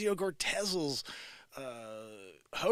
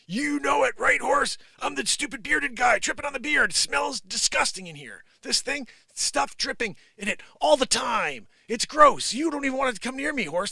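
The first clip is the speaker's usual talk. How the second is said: high, loud comic rant to a horse; mock grievance poured out to the horse; 7 semitones higher, 5 dB louder than usual. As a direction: play self-disgusted, pitched up. Plays bearded man talking to horse.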